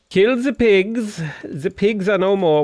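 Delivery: high, drawn-out mock German accent; silly German accent. Gamer joking about killing pigs, silly German accent. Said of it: "do that in a German accent".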